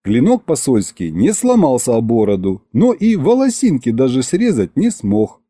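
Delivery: ordinary delivery, nothing marked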